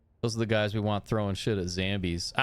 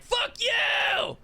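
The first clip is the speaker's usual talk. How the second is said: very high, louder shouted curse; over-the-top shout; 3 dB louder than usual. Acted. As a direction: top the last yell. Retold troll back-and-forth.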